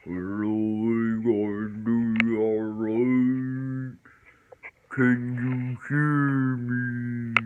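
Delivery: extremely drawn-out, distorted words; slow motion. Retold or imitated. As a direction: imitate speech in slow motion. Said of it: "it's like slow motion".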